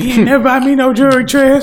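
high, fast complaining ex voice; imitating ex-girlfriend. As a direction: whine it like a jealous ex. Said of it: "They're like".

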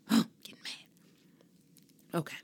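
sharp gasp, then a whispered confession. Real, not acted. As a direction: catch your breath and whisper it. Catching herself getting worked up.

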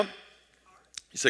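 usual speaking voice

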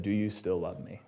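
a hushed tender question; soft voice of God. Retold or imitated. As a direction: ask it gently, barely above a breath. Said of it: "And it said".

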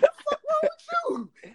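squealed indignant reaction; retold shocked outrage. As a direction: reenact your disbelief, voice shooting way up. Retold shocked reaction to thrown plate.

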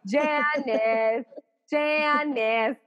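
drawn-out foghorn call; Janice from Friends. Retold or imitated. Imitating sitcom girlfriend for friend, long foghorn call. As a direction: blare the name out long like a foghorn. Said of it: "calls you like a foghorn, Chandler Bing".

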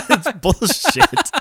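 high, slow laughing words. Real, not acted. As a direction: laugh through it. Laughing at the story.

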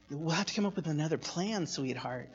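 quick, soft, dropping low on sweetheart; quietly sweet, through a smile; smiling motherly warning. Retold or imitated. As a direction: imitate mom's hushed sweet threat. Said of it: "she smiled as she said it".

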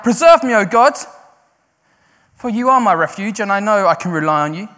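crying out. Retold or imitated. David crying out to God as refuge. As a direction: cry out, then settle into confidence. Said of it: "He's like crying out".

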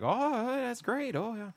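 high, slow imitation Canadian accent; thick Canadian accent. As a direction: stretch it out in a high, hammed-up Canadian lilt. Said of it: "this guy has a real thick accent".

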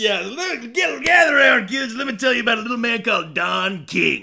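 loud, high-pitched hammed-up storyteller; mock storyteller. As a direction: call the kids round for a tall tale. Mock storyteller gathering children round.

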